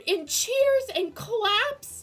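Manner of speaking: high and loud, thrilled; excited shouting. Real, not acted. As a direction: gush it out at full volume. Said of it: "Sorry for my incessant screaming".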